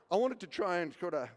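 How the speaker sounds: ordinary talk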